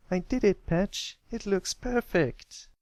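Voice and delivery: high, slow, proud little colt; a small pony beaming at his finished work; young colt voice. Acted. Little brother proudly showing his work, in a high young voice.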